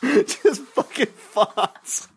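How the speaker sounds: high, very slow, wheezed through laughter; real breakdown into giggles; helpless laughter. Real, not acted. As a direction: barely get the punchline out for laughing. Collapses laughing at the punchline.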